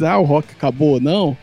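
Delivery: usual speaking voice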